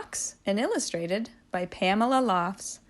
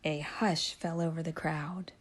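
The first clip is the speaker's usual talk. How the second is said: whispered; hushed narration; 5 semitones lower, 11 dB quieter than usual. A narrator's reading. Narrator whispering as the crowd goes quiet. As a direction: drop to a whisper for the hush.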